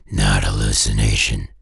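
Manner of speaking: usual speaking voice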